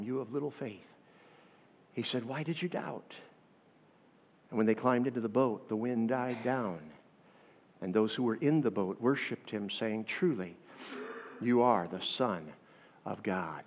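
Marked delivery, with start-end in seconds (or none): 0.0-3.1 soft, quieter voice of Jesus; gentle rebuke. Acted. Jesus's words read gently. 10.0-13.6 low, slow and hushed; awed confession. Acted.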